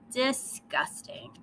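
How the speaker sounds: soft, slow, drawn-out disgust. Acted. Lily sneers at her parents' praise of the baby, slow and soft. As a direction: let the word curdle with distaste.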